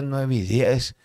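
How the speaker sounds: usual speaking voice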